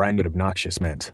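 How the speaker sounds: ordinary talk, nothing marked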